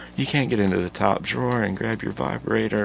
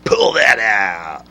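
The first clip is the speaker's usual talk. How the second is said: imitating a news producer; 5 dB louder than usual.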